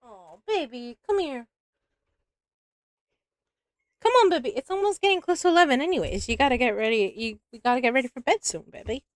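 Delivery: high, soft coaxing coo. Real, not acted. Streamer coos to her cat that it is time for bed. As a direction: gently coax the cat toward bedtime.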